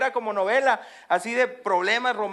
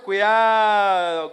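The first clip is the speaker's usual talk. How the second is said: Cuidado stretched very slowly and louder; drawn-out warning; 7 dB louder, much slower than usual. Real, not acted. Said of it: "¿eh, muchachos?".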